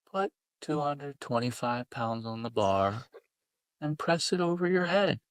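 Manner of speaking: a light send-up of a sultry coach; teasing a gym client in a faintly breathy voice; mock sexy breathy voice. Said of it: "in a sexy, breathy voice".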